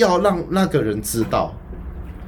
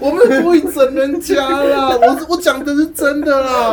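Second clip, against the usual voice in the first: louder, higher, half-laughing denial; laughing protest; 8 semitones higher, 3 dB louder than usual. Real, not acted. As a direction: insist it through laughter. Protesting friends' teasing.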